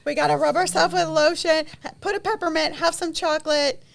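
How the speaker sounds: piling it on, laughing; excited. Acted. Teasing over-the-top self-care list.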